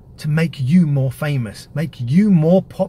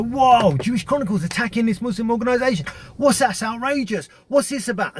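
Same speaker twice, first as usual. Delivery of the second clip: imitating a shocked, higher-pitched reaction; shocked outrage; 7 semitones higher than usual. Muslims hearing of the attack, mock shocked outrage. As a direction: voice the crowd's outraged disbelief.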